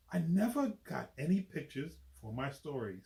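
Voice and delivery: ordinary delivery, nothing marked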